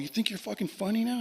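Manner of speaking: high-pitched angry challenge; retold rage. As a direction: confront him indignantly, without shouting. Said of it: "Now I'm fucking full-on rage".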